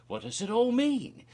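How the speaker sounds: high, loud puzzled question; mock wonder. Real, not acted. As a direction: ask it like a baffled fan.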